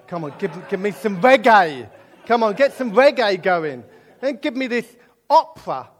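loud, laughing call for reggae; hammed-up loud plea for real music; mock demanding. Husband mocking his wife's taste, loud and laughing. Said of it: "I'm like".

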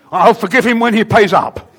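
loud, high, laughing refusal; laughing defiant retort. Retold or imitated. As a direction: snap back with a laugh.

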